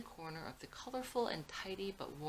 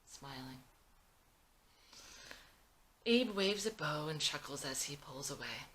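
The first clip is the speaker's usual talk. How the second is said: ordinary delivery, nothing marked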